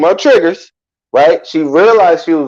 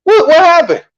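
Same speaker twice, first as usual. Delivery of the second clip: startled; 11 semitones higher than usual. Retold or imitated. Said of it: "I was like".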